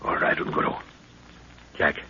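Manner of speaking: voiceless whisper. Acted. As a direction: whisper the go-ahead. Sneaking to the hut.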